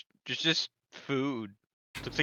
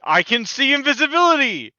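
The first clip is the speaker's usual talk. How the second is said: excited exclaim; 10 semitones higher, 9 dB louder than usual. Real, not acted. Player jokes, laughing loudly.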